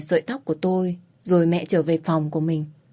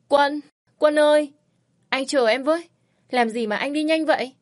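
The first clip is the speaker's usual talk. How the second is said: pleading call; 8 semitones higher than usual. A narrator's reading. Dream, calling after Quân.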